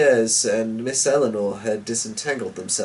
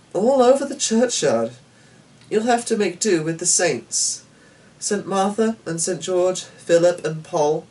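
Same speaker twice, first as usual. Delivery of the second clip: put-on higher, brisk girl's voice; young girl voice; 7 semitones higher than usual. A narrator's reading. Daughter telling her father the window is gone, bright girlish voice.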